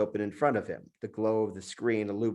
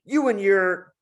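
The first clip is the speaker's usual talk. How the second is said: high, loud sputtering start; frantic anger; 9 semitones higher, 7 dB louder, slower than usual. Acted. Julian sputters at the monkey, loud and frantic. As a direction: grope for words in rage. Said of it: "struggled to find the right words".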